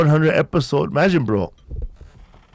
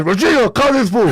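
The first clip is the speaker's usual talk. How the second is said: higher, louder mock voice of his clueless self; mock helpless self; 9 semitones higher, 4 dB louder than usual. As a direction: play the dumb guy calling for help. Mocking himself lost finding rig.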